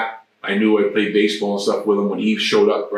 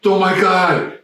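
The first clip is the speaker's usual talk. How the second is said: high, loud dismayed exclamation; horrified at what just happened; shocked gasp; 9 semitones higher, 4 dB louder, slower than usual. Retold or imitated. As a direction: rookie losing his mouthpiece, shocked. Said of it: "Matt goes".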